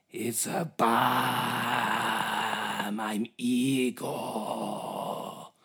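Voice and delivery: very slow, drawn-out creepy voice; slow creepy spy. Retold or imitated. Spy introducing himself to officials, slow and creepy. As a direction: stretch every word like a sinister henchman.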